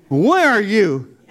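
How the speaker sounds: slow, loud, strained repeat; anguished plea to God. Retold or imitated. Begging God for an answer in the dark, slow loud anguish. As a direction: drag it out, pleading and broken. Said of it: "I'm yelling at God".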